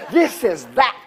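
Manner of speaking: loud, slow, preaching at full force; shouted testimony. Retold or imitated. To the forklift guy, a full-voiced shouting proclamation.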